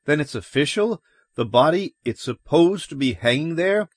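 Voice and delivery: high, eager, rising question; hopeful questioning. Acted. Man asking cops if the body is official, hopeful. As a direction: ask pitched high, lifting at the end.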